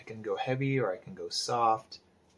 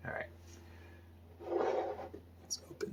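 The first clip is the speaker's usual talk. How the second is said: whispered, a little higher; whispered aside; 9 dB quieter, much faster than usual. Real, not acted. Artist opening his ink, whispering to himself. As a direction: murmur it as you open the ink.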